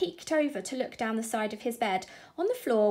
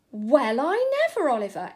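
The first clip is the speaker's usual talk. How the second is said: loud, amazed mum; astonished exclaim; 3 semitones higher, 7 dB louder than usual. Acted. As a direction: exclaim it in delighted disbelief. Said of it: "exclaimed the grown-up".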